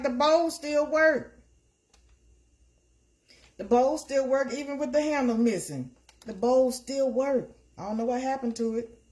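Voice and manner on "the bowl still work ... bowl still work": insistent. Retold or imitated. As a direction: insist the bowl still works. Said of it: "But I'm like".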